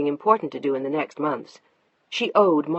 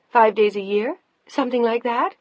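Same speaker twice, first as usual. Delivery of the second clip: higher, louder sarcastic jab; bitter sarcasm; 6 semitones higher, 5 dB louder than usual. Acted. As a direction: push the bitterness further. Wife escalates sarcastically.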